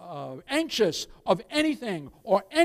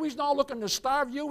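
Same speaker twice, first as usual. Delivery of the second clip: usual speaking voice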